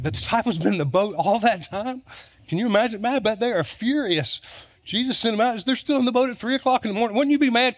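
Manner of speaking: high-pitched mock outrage; mock indignation. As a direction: play up the disciples' annoyance. Voicing disciples' fury, pitch raised.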